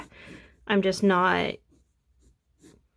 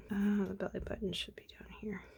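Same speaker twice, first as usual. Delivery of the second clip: whisper; 12 dB quieter, faster than usual. Real, not acted. Muttering to self while drawing.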